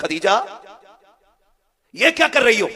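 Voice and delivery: loud, high questioning cry; acted exclamation. Preacher voicing a husband questioning his wife, loud exclamation. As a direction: call out the startled question at full voice.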